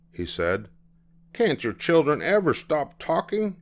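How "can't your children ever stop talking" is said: high-pitched huffy question; exasperated complaint. A narrator's reading. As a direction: protest the children's chatter, pitched high. Irritated passenger complains about noisy children.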